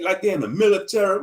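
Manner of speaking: normal talking voice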